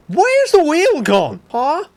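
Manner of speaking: high, loud, slow astonished question; real bafflement. Real, not acted. Wheel vanished.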